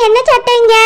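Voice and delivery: normal talking voice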